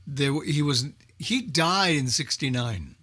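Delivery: ordinary delivery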